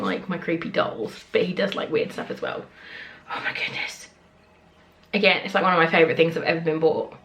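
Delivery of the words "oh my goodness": soft whispered wonder; awed whisper. Real, not acted. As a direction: breathe it in awe. Whispered awe.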